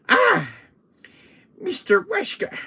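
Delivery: high-pitched, teasing little villain; squeaky mock-villain greeting; annoying little voice. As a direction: pipe up in a silly high voice. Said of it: "Salazar's little annoying little voice".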